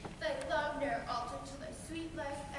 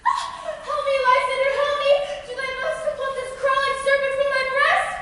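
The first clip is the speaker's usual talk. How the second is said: loud high screaming for help; panic, shrieking for Lysander; terrified scream; 8 semitones higher, 16 dB louder than usual. Acted. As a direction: wake screaming from a nightmare. Hermia waking from a nightmare.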